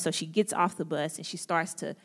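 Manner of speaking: usual speaking voice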